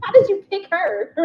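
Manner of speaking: high, loud incredulous question through laughter; laughing mock disbelief. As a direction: play the baffled classmates while cracking up.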